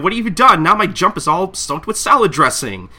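loud, high indignant complaint; outraged customer. Retold or imitated. Customer yelling at Kagura over his ruined Jump, loud and high. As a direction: yell it up high in disbelief. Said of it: "He's like".

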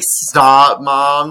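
loud mock plea to mom; playfully exasperated outburst. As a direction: protest like an embarrassed kid, hammed-up. Retold protest to embarrassing mom.

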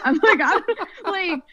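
high, breaking into laughter mid-sentence; laughing. Real, not acted. Retelling her panic to the host, laughing. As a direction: laugh through the words.

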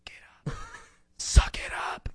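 hushed unvoiced whisper. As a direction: hiss it sideways to the crying best man. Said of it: "she goes".

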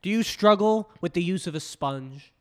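loud, high infomercial pitch; hard sell ad. Pretend. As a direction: sell it like a late night ad. Infomercial parody continues.

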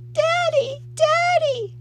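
put-on very high, loud child's wail; weeping wail. A narrator's reading. Little girl clinging to her father, wailing. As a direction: wail for a parent. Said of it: "she wept".

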